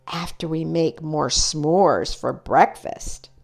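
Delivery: ordinary delivery, nothing marked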